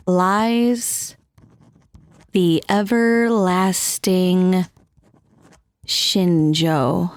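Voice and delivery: slow, low reading of stage directions; stage directions read aloud. Acted. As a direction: set the scene like a script reading, slowly. Reads her play's stage directions.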